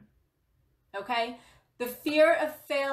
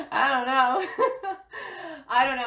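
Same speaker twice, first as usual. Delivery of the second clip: teary laugh; 3 semitones higher, 6 dB louder than usual. Real, not acted. Laughing emotionally after the rant.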